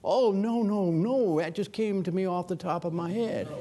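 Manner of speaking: high, bright mock denial with a laugh; laughing mock Reagan protest; imitating Reagan. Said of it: "Reagan said".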